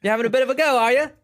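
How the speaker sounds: loud, high, hammed-up Cockney; Cockney accent. Shown as a demonstration. American showing off his British accent, loud mock Cockney. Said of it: "more of a kind of Cockney accent there".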